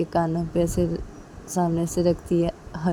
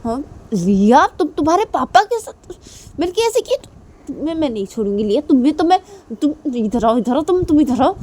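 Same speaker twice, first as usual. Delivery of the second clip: much higher, louder mock threat; threatening; 9 semitones higher, 8 dB louder than usual. Mother threatens daughter for the prank, angry. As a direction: come here, you're in trouble.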